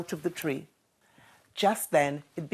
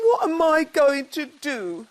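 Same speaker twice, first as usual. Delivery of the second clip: high, tearful cub voice; sniffling; 9 semitones higher, 4 dB louder than usual. A narrator's reading. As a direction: sniff out a worried question. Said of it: "sniffed Douglas".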